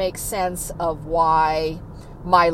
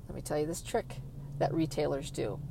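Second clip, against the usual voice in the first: confiding; 5 semitones lower, 13 dB quieter than usual. Real, not acted. Dropped to a confiding tone.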